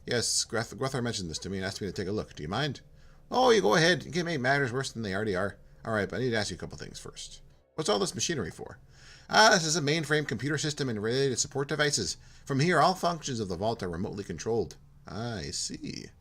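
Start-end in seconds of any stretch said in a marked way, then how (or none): 3.3-5.6 put-on polite, lightly done Minnesota accent; hint of a chirpy Minnesota accent. Jim welcoming Porto in a light Minnesota accent. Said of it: "He's from Minnesota". 9.3-14.8 put-on polite, lightly done Minnesota accent; hint of a chirpy Minnesota accent. Jim welcoming Porto in a light Minnesota accent. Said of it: "He's from Minnesota".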